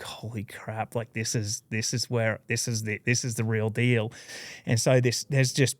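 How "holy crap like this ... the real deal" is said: hushed, much softer awe under the breath; awed hush. Retold or imitated. Standing in the huge crowd, an awestruck hushed murmur. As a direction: breathe it like you can't believe your eyes.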